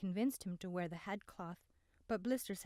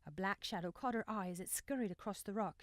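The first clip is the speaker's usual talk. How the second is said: usual speaking voice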